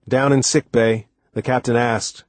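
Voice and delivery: usual speaking voice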